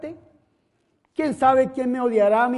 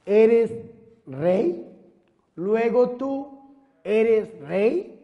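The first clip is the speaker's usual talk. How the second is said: Pilate asking; 2 semitones lower, much slower than usual. Acted. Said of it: "Pilato le pregunta al Señor".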